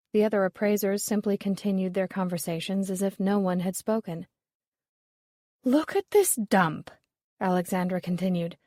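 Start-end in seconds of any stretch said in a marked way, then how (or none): 5.6-6.9 slow, higher scornful drawl; disgusted sneer. A narrator's reading. Snobbish Alexandra sneering at the restaurant. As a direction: look down your nose at the place.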